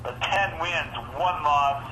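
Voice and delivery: sports announcer reading the records; lightly hyped matchup call; announcer intro. Acted. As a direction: introduce the teams like a broadcast.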